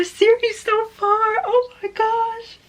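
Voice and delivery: high, slow, drawn-out squeal; excited squeal. Real, not acted. Finishing her series tour, excited drawn-out squeal. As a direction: gasp it out in delight.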